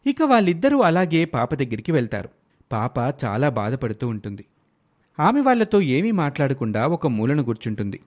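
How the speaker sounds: ordinary delivery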